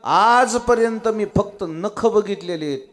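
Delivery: high, loud wounded appeal; impassioned protest. Acted. Lakshman defends his honour to Sita, impassioned protest. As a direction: plead your innocence at full voice.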